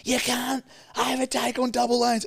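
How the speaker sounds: very high-pitched mock yell; imitating angry screamer. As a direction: scold like an enraged driver. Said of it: "he's screaming at me".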